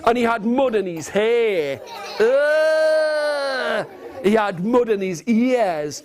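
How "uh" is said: disgusted groan. Acted. Disgust at mud.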